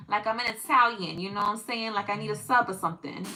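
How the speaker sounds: put-on Italian-American New York accent; casual mock New York Italian accent. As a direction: play a New York Italian ordering a sub. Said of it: "a New York".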